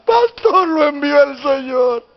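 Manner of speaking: high, wailing mock cry; imitating tearful woman. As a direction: cry it out as the weeping church sister. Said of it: "Y se echa a llorar". (imitando a una hermana que llora)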